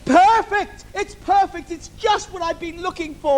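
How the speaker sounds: villain spotting the lamp; excited. Acted. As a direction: pounce on it with glee.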